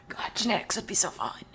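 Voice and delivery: hushed, dreamy whisper; whispered longing. Real, not acted. Daydreaming to chat about genetics, whispered. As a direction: breathe it out like a secret wish.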